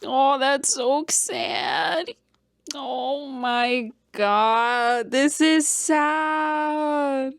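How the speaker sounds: high, long-drawn sad whine; over-dramatic, slow, high lament; about to cry. Real, not acted. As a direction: stretch every word out slowly in a pitying wail. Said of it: "I literally am gonna cry".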